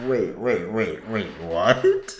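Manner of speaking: stunned "wait, wait"; surprised. Real, not acted. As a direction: stop the clip in disbelief. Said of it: "I have to listen to this again".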